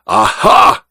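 high, rising "aha"; triumphant cry. A narrator's reading. Duke hearing of his nephew's friends, crying out. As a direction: cry out as if catching on. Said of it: "cried the Duke".

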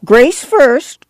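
high, insistent clamor; clamor for it as an impatient old woman; shrill insistence; clamoring grandma. A narrator's reading. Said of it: "Grandma clamored".

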